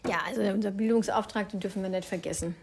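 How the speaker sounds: just swallowed a drink; talking right after a sip; while sipping a drink. Real, not acted. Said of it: "ich muss kurz trinken".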